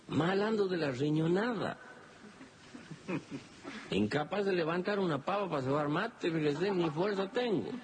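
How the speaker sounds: voz de viejo paisano, más aguda; paisano quejoso. Acted. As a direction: pide ayuda con queja suave. Florentín se queja. (Florentín enfermo le pide revisión con voz aguda)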